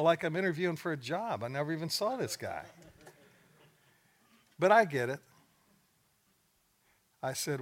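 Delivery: ordinary talk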